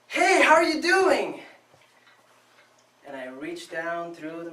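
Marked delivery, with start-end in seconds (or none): 0.1-1.4 much higher and much louder, slow and sing-song; a cheerful mother calling her son; bright mom greeting. Retold or imitated.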